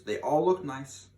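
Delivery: ordinary delivery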